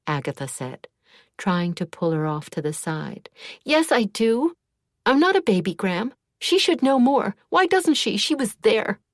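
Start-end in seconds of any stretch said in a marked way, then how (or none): 3.6-9.0 high, loud and upset; angry outburst. A narrator's reading. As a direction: lash out, near tears. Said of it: "erupted into a squall of emotion".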